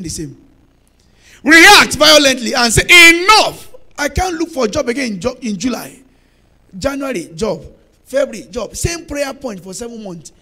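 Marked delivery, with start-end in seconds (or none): 1.4-8.7 loud, fiery demand; preaching at full force. Acted. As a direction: hurl it out defiantly.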